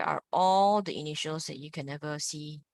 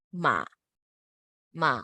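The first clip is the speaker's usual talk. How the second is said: MA dipped low, then up a bit; dipping third tone; 4 semitones lower, 3 dB louder than usual. Shown as a demonstration. Teacher modelling the third tone, down then up. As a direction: scoop MA down and back up. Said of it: "Third tone".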